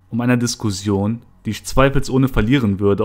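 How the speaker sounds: ordinary delivery, nothing marked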